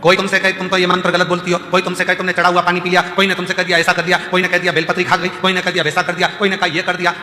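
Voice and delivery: preaching exhortation. Real, not acted. Preacher telling the congregation not to worry, impassioned exhortation. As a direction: reassure the crowd with loud, rolling conviction.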